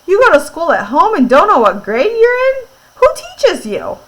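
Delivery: high, loud incredulous question; puzzled. A narrator's reading. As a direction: voice a baffled schoolboy, pitch leaping up. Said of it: "Puzzled, he said".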